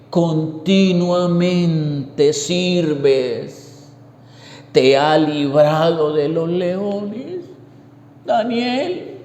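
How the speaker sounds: slow, mournful call down into the pit; a worried king calling his servant, slowly and sadly. Acted. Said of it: "él le puso voz triste".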